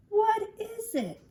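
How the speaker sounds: high, loud, stretched question; worried schoolboy, drawn out; fretting child. Acted. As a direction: puzzle over it in a high kid's voice. Drew racking his brain.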